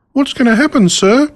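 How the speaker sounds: put-on a little boy asking nervously, unsure what comes next; frightened little boy. A narrator's reading. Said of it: "somewhat frightened".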